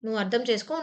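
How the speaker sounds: usual speaking voice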